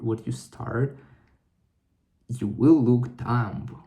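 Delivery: normal talking voice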